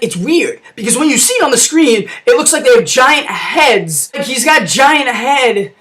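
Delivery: normal talking voice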